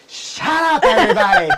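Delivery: hammed-up, high-pitched yell breaking into laughter; upset shout. Upset organizer to people not paying attention, mock furious shout. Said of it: "you'd be very upset, like".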